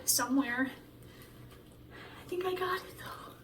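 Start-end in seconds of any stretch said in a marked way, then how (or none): 2.2-3.3 whispered to herself; hushed, almost disbelieving. Real, not acted. Singer alone after the take, whispering.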